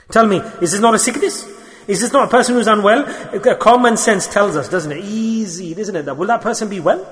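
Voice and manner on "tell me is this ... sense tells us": ordinary delivery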